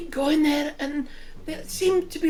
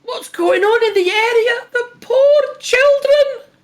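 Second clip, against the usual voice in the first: high, loud desperate plea; distressed villager; 8 semitones higher, 9 dB louder than usual. Acted. Villager woman crying out to travellers, high and desperate. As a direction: cry out to passersby for the children.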